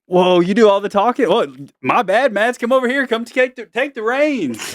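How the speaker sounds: high-pitched mock fuss, dragging out the last word; mock alarm. Dad jokingly handing the host job to his kid, mock alarm. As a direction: make a playful show of surrendering, pitched high.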